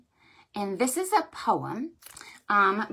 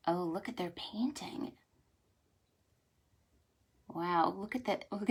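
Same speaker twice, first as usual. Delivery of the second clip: hushed wonder; 3 semitones lower, 10 dB quieter than usual. Acted. Showing illustration to kids.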